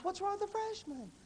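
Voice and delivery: high-pitched mock bafflement; everybody complaining. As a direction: ask it high, imitating puzzled critics. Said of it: "everybody goes".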